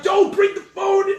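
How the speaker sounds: high, loud mock yell of panic; wife frantically warning her husband not to bring the phone; frantic shout. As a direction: yell it as if mouthing a silent no.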